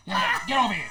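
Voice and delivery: loud summons in a borrowed star voice; Rick impression. Star ordering youngster over to him, loud impression. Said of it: "That's him".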